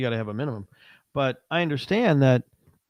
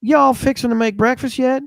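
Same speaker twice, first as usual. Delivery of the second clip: put-on Southern diner waitress accent; 7 semitones higher, 5 dB louder than usual. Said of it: "all of a sudden she's Flo from Mel's Diner".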